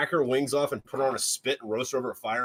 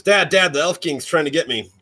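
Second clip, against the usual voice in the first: loud, high cry for Dad, easing off; acted alarm of a child calling for help; spooked kid; 3 semitones higher, 9 dB louder than usual. Kid telling Dad about the Elf King, spooked. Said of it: "Kid gets spooked out".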